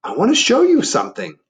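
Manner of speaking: high and loud, eerie invitation; voice of the dark. Acted. The dark luring Laszlo, eerie and loud. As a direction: beckon him eerily. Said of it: "said the dark".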